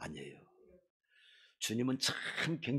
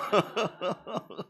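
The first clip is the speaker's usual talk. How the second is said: laugh; 3 semitones higher, 7 dB quieter than usual. Real, not acted. Laughs after own joke.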